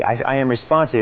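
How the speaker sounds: ordinary talk, nothing marked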